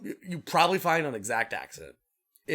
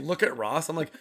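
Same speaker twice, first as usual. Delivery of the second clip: ordinary talk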